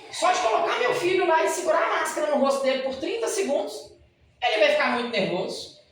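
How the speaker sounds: mother phoning from the clinic in alarm; panicked mother. Retold or imitated. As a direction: blurt it like a frantic mother on the phone. Mother calls dentist in panic.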